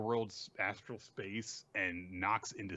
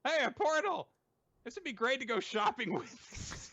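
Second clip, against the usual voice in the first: Natsuko. Retold or imitated. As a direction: pipe up in a squeaky character voice.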